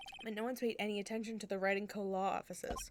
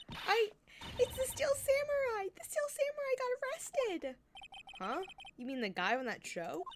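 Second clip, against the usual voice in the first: higher, alarmed and urgent; frantic girl; 3 dB louder than usual. Acted. Maya telling Nick the hero was arrested, frantic high voice. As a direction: blurt the news in a panic. Maya's panicked news.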